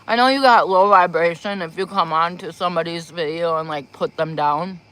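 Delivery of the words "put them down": usual speaking voice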